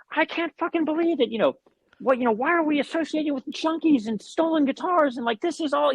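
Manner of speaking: high, mock furious rant; retelling mom screaming in the car, mock rage; imitating mom's rant. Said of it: "road raging and screaming".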